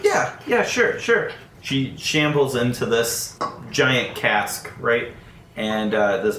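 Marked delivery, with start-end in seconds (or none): 0.0-1.3 nervous stammer. Acted. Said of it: "Tin's like".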